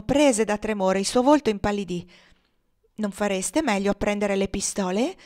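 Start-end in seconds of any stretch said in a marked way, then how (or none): none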